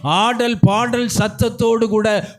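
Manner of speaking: preaching that climbs in pitch and force; impassioned exhortation to a congregation; building sermon fervour. Real, not acted. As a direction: drive the questions home, building to a high pulpit cry.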